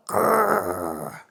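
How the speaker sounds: deep monster growl; playful monster growl; low growl. Acted.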